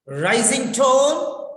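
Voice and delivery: "Rising tone" with "tone" lifting high. Shown as a demonstration. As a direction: let "tone" climb like an authoritative question. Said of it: "question of authority".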